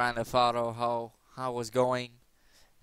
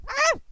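mock pain cry; 8 dB louder than usual. Pained cry after feet.

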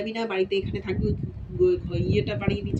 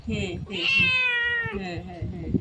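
soothing coo; 14 semitones higher than usual. Real, not acted. Soothing the cat.

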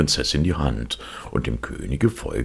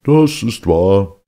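loud, slow, higher booming ogre; gruff ogre; 7 semitones higher, 9 dB louder, much slower than usual. Acted. Ogre answers the cat gruffly, loud and slow. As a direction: boom it out with brute pride. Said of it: "antwortete der Oger barsch".

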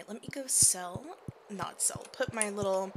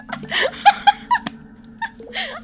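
a big, high burst of laughter; loud helpless laugh; 13 dB louder than usual. Real, not acted. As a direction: laugh hard at your own disaster. After dying in game.

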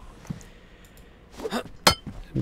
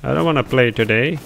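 usual speaking voice

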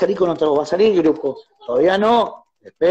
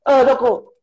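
imitating a cocky caller, higher and looser; mocking cocky tone; 6 semitones higher, 3 dB louder, slower than usual. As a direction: play the arrogant guy calling out. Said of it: "Me habló así". (imitando con tono canchero)

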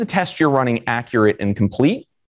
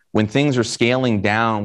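ordinary delivery

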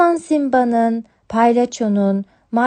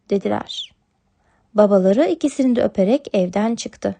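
plain reading voice

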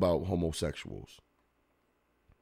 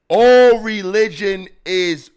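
loud, slow, defiant; all religion is bullshit, said loud and drawn out; emphatic declaration; 9 semitones higher, 10 dB louder, much slower than usual. Real, not acted. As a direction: proclaim it to provoke everyone. Provocative declaration after warning.

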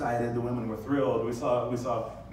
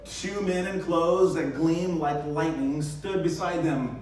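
ordinary talk

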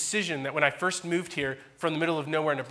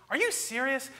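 incredulous God; 8 semitones higher than usual. Acted. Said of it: "Even God is like".

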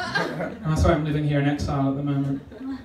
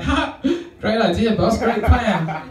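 nervous laugh; 3 semitones higher, slower than usual. Acted. Sidekick laughing along nervously.

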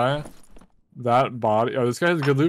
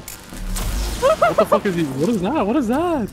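shocked; 11 semitones higher, slower than usual. Real, not acted. Sudden shock at strange enemy.

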